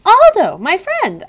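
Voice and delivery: high, loud happy greeting; glad squeak. A narrator's reading. Hamster spotting his friend the custodian, delighted squeak.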